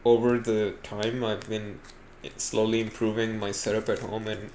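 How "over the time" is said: usual speaking voice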